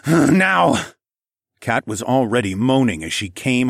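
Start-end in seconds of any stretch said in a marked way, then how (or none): 0.0-0.9 loud, raised, grunting order; urgent command. A narrator's reading. Narrator commanding Kat at his peak, urgent. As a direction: order it between heavy grunts.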